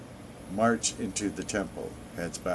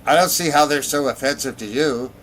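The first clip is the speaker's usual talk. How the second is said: loud, raised voice with a touch of amusement; lightly amused, higher and louder; laughingly said; 6 semitones higher, 10 dB louder than usual. A narrator's reading. Breton answering the Nord, mildly amused. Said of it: "laughed one of the Bretons".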